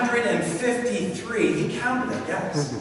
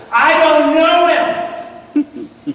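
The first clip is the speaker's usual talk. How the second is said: loud, high, drawn-out yell; Peter yelling; 8 semitones higher, 7 dB louder, much slower than usual. Retold or imitated. Cornered disciple denying Jesus at dawn, yelled. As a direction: yell the denial slowly, every word stretched. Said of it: "cursed and yelled".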